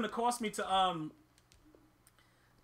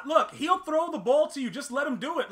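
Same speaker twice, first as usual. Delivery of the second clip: normal talking voice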